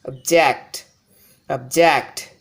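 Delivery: obJECT, weak ob and strong ject; stress on second syllable. Shown as a demonstration. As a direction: drill object with the weight on JECT. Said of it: "If I give more emphasis on the second syllable".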